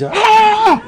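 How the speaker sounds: high loud shriek of fright; sudden high startled scream; retold scream. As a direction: shriek as if something jumped out of the cupboard. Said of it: "So she screams".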